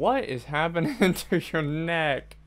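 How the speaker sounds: loud, high, drawn-out cry breaking into laughter; laughing disbelief. Real, not acted. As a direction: crack up while exclaiming in shock. Cracks up at glitched neck.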